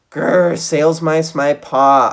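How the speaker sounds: loud, slow, growling outburst; angry cry. A narrator's reading. Geronimo snapping at Trap over bad clams, loud and angry. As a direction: spit out the grr like a furious mouse. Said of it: "I cried".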